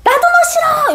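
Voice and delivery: very high, petulant mock retort; childish mom. Mom fighting back at her child, high childish retort. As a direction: throw it back like a squabbling kid. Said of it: "이렇게 하는 엄마들".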